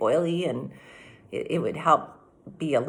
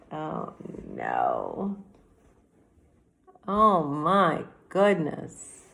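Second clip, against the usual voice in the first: ordinary delivery, nothing marked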